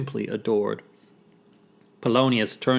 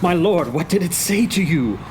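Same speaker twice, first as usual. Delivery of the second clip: higher, urgent question; puffing; 5 semitones higher than usual. Acted. Horatio catching up with Hamlet, puffing. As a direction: ask eagerly, as if puffed. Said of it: "Horatio puffed".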